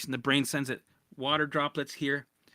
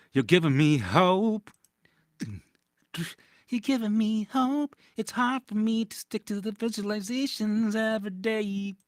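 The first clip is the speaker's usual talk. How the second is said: commenter voice; 6 semitones higher than usual. Retold or imitated. Reading commenters in put-on voice.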